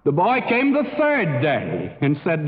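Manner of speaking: normal talking voice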